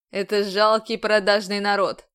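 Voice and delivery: a commander bearing down on her; stern commander. A narrator's reading. Commander role.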